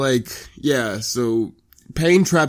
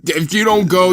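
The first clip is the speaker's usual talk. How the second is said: raised, forceful urging; mock stern mentor; 7 semitones higher, 4 dB louder than usual. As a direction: lay down the ultimatum. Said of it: "He goes".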